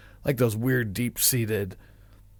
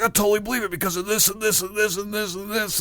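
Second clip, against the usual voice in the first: higher, wound-up conspiracy believer; acting crazy; 9 semitones higher than usual. Acting crazy to wind up a friend, a hint of manic believer. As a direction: rattle it off like a zealot. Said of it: "I'm just acting crazy".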